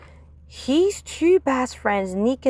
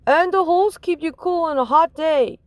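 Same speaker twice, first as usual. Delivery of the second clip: put-on higher, eager kid; boy's voice; 7 semitones higher, 3 dB louder than usual. Stuart telling the teacher, higher. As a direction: point it out like a clever kid.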